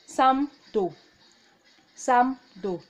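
sam do spoken clearly; Korean reading. Shown as a demonstration. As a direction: model sam do for the class. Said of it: "another example is".

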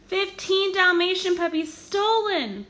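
high, loud, slow alarm call; urgent bark. A narrator's reading. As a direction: call it out across the city. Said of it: "Pongo barked".

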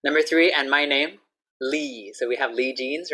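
normal talking voice

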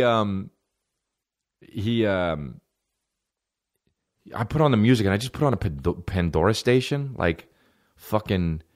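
ordinary talk, nothing marked

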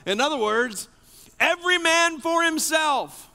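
urgent sermon peak; full-force preaching. Acted. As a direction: hammer the point to the congregation. Preacher's climax on the revolt.

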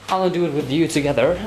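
ordinary talk

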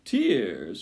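loud rat cry; loud, jeering exclamation; shouting. A narrator's reading. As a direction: shout it as the pack. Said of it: "shouted the rats".

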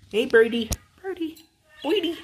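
very high birdie call; squeaky, playful call to a pet bird. Real, not acted. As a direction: climb into a tiny high cooing voice.

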